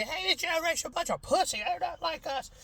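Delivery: put-on grumpy old-timer. Old-timers griping about the young, high mocking sneer. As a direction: sneer like an old man at kids. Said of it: "everybody's always like".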